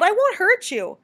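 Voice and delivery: high, loud assurance; calling out. A narrator's reading. Bat calls to the hidden chipmunk, loud and high. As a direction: call reassurance after someone hiding. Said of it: "the bat called".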